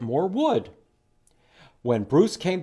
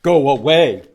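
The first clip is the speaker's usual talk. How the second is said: stern; 11 dB louder than usual. Acted. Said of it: "Bruce was very stern".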